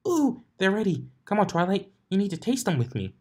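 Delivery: high, eager and quick; excited. A narrator's reading. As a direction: bounce with excitement, rush the end.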